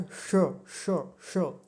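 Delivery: श with the tongue curled back, held and slow; retroflex sh. Shown as a demonstration. Teacher demonstrating the sound, slow and drawn out. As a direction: curl the tongue back and hiss श. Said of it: "तो कैसे बोला जाता है".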